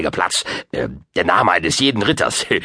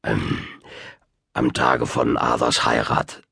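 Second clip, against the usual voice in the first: put-on low, reluctant old man; abashed; 8 semitones lower, slower than usual. A narrator's reading. Old wizard confirming the boy's fear, abashed. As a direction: admit it low and hesitant. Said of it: "Merlin blickte betroffen drein".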